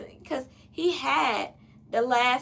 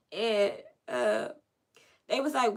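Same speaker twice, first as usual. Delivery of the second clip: normal talking voice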